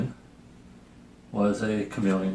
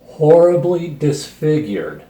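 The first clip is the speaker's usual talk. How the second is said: higher, louder and slowed, hammed-up horror; mock dramatic; 6 semitones higher, 11 dB louder than usual. Finishing the chameleon's fate as a joke, hammed-up loud and slow. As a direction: land the punchline with mock gravity. Comic punchline finishing co-host's sentence.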